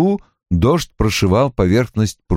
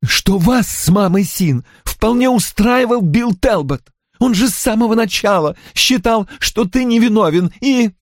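put-on higher, pleading young woman's voice; a daughter defending her mother, voiced high; Anna's voice; 12 semitones higher than usual. (высокий женский голос, умоляюще)

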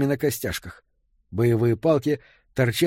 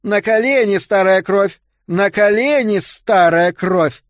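much higher and louder scream; 7 semitones higher, 6 dB louder, slower than usual. Acted. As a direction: scream the command, drawing out the last words. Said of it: "закричала". (пронзительный крик)

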